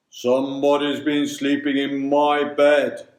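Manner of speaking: loud, far-from-natural big bear voice; great deep bear voice. A narrator's reading. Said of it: "in his great deep voice".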